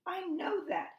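high-pitched, huffed retort; storybook duck in a huff; huffy. A narrator's reading. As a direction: huff it out high, offended at being told. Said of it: "huffed Duck".

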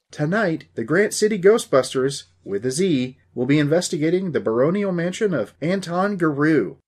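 ghost-show host, playing it up; on-camera presenter. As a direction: announce it grandly to the camera. Dusty presents to his camcorder.

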